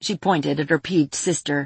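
ordinary delivery, nothing marked

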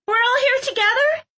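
put-on high-pitched girl's hopeful question; ask, hoping it is true; acted hope in a very high voice. A narrator's reading. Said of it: "Clove sounded hopeful".

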